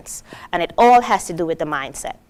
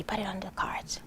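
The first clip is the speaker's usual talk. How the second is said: whispered; 16 dB quieter than usual. Retold or imitated. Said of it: "And then she's like".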